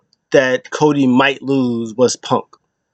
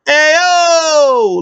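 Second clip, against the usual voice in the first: much higher, louder hey yo; mock Logan Paul call; 4 dB louder than usual. Logan Paul bursting out to the ring, mock hype call. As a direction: shout the entrance call like a hyped influencer.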